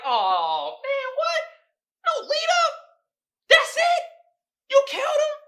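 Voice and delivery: high, loud shocked squeak; shocked disbelief. Retold or imitated. Viewer shocked the villain died so fast, high yelps. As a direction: yelp it like you can't believe it ended. Shocked reaction to sudden kill.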